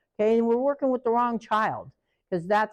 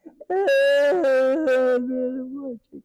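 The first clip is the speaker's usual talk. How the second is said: mock laugh-cry; 3 semitones higher than usual. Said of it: "he's in there going".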